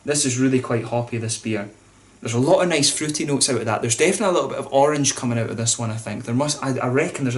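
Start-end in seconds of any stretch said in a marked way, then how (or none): none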